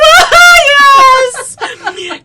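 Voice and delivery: very high screamed sob; hammed-up child's bawling shout; hysterical wailed answer. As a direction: scream the answer through mock tears.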